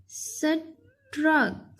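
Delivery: Struck as "स", pause, "truck"; split into parts. Shown as a demonstration. Teacher helping pupils spell, struck split in two. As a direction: say struck very slowly, first sound apart. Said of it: "इसके अलग-अलग".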